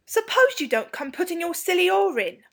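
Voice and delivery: put-on high, indignant boy character; worked-up schoolboy riposte, pitched well up; heated retort. A narrator's reading. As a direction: squabble back hotly in a high voice. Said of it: "who was now warm indeed".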